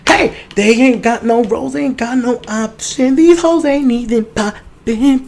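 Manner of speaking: high, slow, mocking drawl; high put-on drawl mocking the girls on the show; mocking girls' voices. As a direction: ham up a whiny girl complaining.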